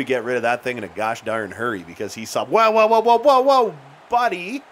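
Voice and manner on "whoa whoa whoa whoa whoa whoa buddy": high, loud rapid whoa whoa whoa; alarmed whoas. Real, not acted. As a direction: shout in alarm as your guy gets hit. Alarmed at a hit on teammate.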